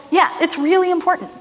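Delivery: usual speaking voice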